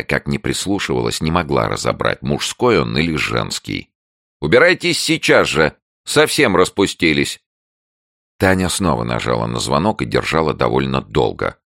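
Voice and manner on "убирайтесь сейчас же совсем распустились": high, loud scolding; angry neighbour. A narrator's reading. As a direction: order them away indignantly. Neighbour drives visitors away. (возмущённый крик соседки)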